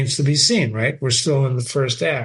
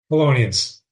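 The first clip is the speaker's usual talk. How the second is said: normal talking voice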